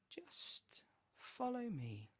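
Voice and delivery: slow, drawn-out eerie whisper; breath-only, high, stretched beckoning; luring whisper. A narrator's reading. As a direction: whisper it slowly to lure him in. Susuke lures him toward death.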